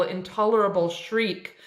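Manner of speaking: usual speaking voice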